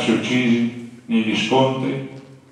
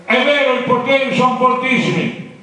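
rally orator; 13 semitones higher, 3 dB louder than usual. Retold or imitated. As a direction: lay out the enemies to the crowd. Capanna addresses the assembly. (oratore da assemblea, lento e forte)

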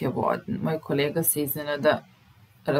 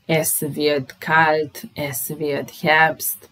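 es wird kalt said slowly, word by word; slow example reading; much slower than usual. Shown as a demonstration. Teacher reads werden examples slowly to the class. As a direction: spell it out slowly for learners. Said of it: "glagol werden".

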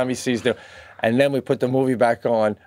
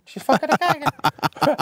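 high, pitched-up jealous whine; paranoid jealous repeat; 13 semitones higher than usual. Retold or imitated. Imagining his wife dreaming of the sailor, pitched up. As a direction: obsess over her imagined affair. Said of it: "I'm like".

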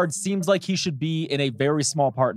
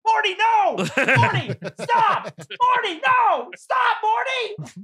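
very high, strained mock yell at moderate volume; imitated yelling; much slower than usual. Podcaster imitates Cody yelling at Morty, high mock yell. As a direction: cry out high, stretching the words in mock panic. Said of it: "Cody just yelling".

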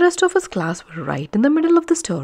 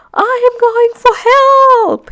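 much higher, louder, slow frightened cry; panicked call; 10 dB louder, slower than usual. Acted. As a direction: yell it running away in fright. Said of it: "he called over his shoulder".

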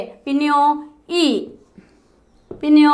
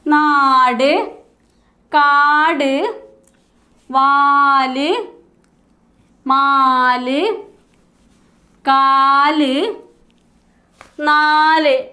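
each word drawn out very slowly, rising at the end; slow read-back; much slower than usual. Shown as a demonstration. Teacher reading the written words back for pupils to notice, slowly. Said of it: "ഇത്രയും പദങ്ങളൊന്ന് ശ്രദ്ധിച്ചേ".

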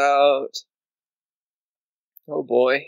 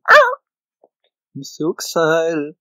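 drawn-out, louder singsong "I'm so excited"; mock excitement; 6 semitones higher, 7 dB louder, much slower than usual. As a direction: stretch it slowly like a cheesy song.

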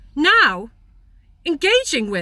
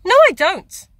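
firm no; 4 semitones higher, 8 dB louder than usual. Real, not acted. Answering own rhetorical question.

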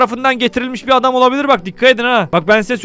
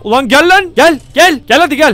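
shouting come on; 4 semitones higher, 5 dB louder than usual. Real, not acted. Urging his car in a race.

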